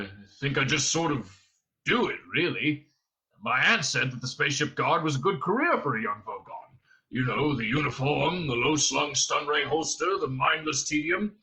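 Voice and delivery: plodding brute character voice; dim Vogon guard. A narrator's reading. Guard telling prisoner about his career, dim and plodding. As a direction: explain it haltingly, thinking as you go.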